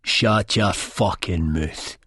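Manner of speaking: ordinary delivery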